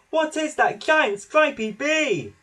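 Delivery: high, slow, loud taunt; teasing call of mocking children. A narrator's reading. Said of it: "the children called".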